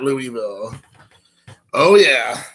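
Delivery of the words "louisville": Louisville as "LOO-ee-vill", softer; wrong pronunciation. Shown as a demonstration. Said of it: "not Louisville".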